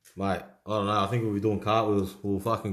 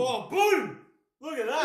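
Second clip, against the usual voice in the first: excited; 13 semitones higher, slower than usual. Real, not acted. Big card pulled.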